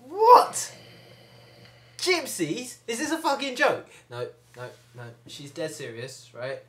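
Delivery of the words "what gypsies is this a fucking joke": high, loud shriek of shock; outraged disbelief. Acted. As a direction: explode in acted disbelief.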